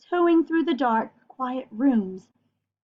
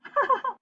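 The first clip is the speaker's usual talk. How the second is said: quick high giggle; short laugh; 12 semitones higher than usual. Real, not acted. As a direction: giggle at the joke. Laughs at headlines.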